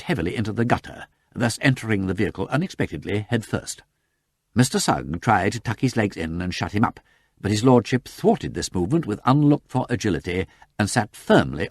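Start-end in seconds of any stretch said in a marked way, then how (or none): none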